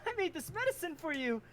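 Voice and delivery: bright, high, cheerful helper voice; eager high character voice. Acted. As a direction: chirp it out like an excited healer.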